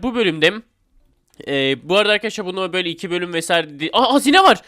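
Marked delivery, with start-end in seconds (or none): 3.9-4.6 excited. Real, not acted. Finds treasure in the game.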